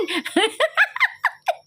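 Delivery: rising squeal of laughter; high laugh. Real, not acted. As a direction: let a high giggle climb. Teasing friend on livestream.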